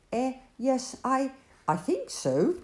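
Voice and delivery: high, hesitant stammer; Obed unsure before Joseph; stuttered. Acted. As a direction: falter over the words. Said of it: "stuttered Obed".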